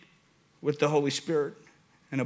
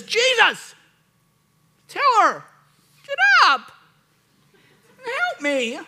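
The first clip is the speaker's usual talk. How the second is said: very high, loud hammed-up plea; mock exasperated yell; 6 dB louder, slower than usual. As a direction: squawk each demand separately like a fed-up sister. Acts Martha yelling for help.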